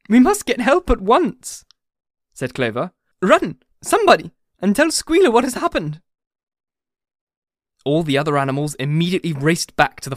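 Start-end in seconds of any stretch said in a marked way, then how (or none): 0.1-1.6 high, loud and drawn-out urgent plea; raised, alarmed storybook voice; urgent call. A narrator's reading. Clover's urgent cry for help. 3.2-6.0 high, loud cry of alarm; urgent character shout; urgent call. A narrator's reading. Clover urgently sends for Squealer.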